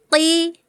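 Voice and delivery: QI from the tongue's root; back qaf. Shown as a demonstration. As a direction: place QI far back, held. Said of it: "pangkal lidah paling belakang".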